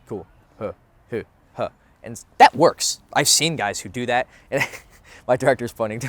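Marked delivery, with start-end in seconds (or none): 0.1-1.7 lightly mocked cool guy, low and flat; a hint of a too-cool pickup guy, mumbled; stoic bro. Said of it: "walking up to a girl and saying".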